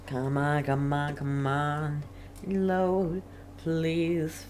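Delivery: ordinary talk, nothing marked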